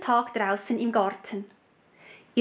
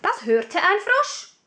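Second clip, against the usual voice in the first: plain reading voice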